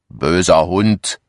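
ordinary delivery, nothing marked

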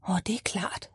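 soft unvoiced whisper; hushed whisper. A narrator's reading. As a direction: whisper it as if realising quietly. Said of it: "whisper heard on both lines".

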